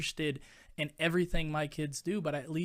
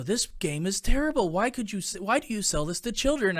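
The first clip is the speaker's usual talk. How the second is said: higher, louder mock outrage from the mom; angry mom's complaint; 6 semitones higher, 7 dB louder than usual. Mom storms back into the game store, angry and loud. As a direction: play the furious customer, pitched up and loud. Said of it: "that mom come back ... and go".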